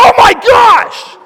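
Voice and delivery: high, drawn-out acted shout; slow, loud cry of mock astonishment; shocked exclamation. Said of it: "the crowds are like".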